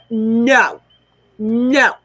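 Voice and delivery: loud, flat refusal; angry no. Real, not acted. Firm no to the auntie who blamed her. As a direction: bark out no, fed up for real.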